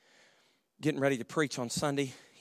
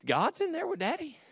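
high puzzled question; childlike surprise; 13 semitones higher than usual. Retold or imitated. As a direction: wonder aloud like a little boy. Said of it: "I was thinking".

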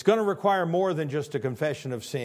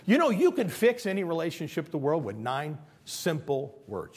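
ordinary talk

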